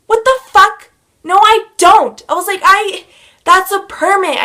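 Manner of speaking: loud, high-pitched outraged denial; indignant protest. Retold or imitated. Acted argument with the officer.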